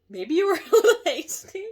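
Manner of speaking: loud, high burst of laughter through the words; laughing out loud. Real, not acted. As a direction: crack up at your own slip. Laughs at own slip.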